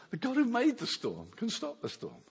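ordinary delivery

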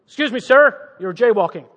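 loud mock American cop; American accent. Police officer stopping a jaywalker, American accent. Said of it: "That's an American accent".

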